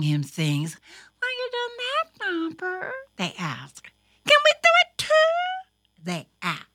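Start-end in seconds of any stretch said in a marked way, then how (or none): 1.2-2.9 put-on high, small child's voice; little sister. A narrator's reading. Little sisters pestering with questions. 4.3-5.4 put-on very high, loud, rapid kid voice; eager little sister. A narrator's reading. Little sisters pestering with questions.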